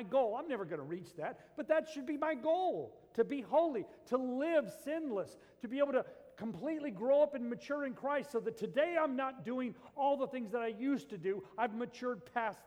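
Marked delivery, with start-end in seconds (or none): 1.6-11.1 rising preaching intensity. Real, not acted. Preaching build on holiness.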